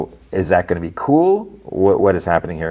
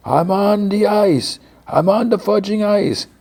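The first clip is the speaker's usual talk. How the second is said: high-pitched, held-back mock holler; mock distant calling; 13 semitones higher than usual. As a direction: pretend to holler up a cliff. Irving calls up from the ice.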